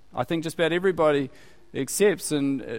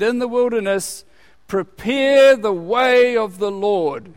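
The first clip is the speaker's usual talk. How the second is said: loud, slow, higher prophetic cry; proclaiming prophet; 5 semitones higher, 6 dB louder than usual. Acted. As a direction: call it out like John in the desert. Preacher proclaiming John's cry.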